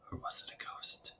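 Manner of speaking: hushed spooky whisper. A narrator's reading. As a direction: breathe it like a secret. Narrator wonders about ghost.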